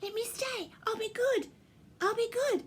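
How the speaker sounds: high-pitched pleading little reindeer; squeaky, insistent begging; pleading Ruby. A narrator's reading. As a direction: beg in a high, urgent little voice. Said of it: "heard Ruby say".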